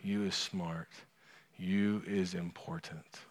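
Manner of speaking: soft, slow, loving voice; soft, slow and gentle; tender affirmation. Retold or imitated. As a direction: speak as a loving father looking you in the eyes. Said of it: "and saying".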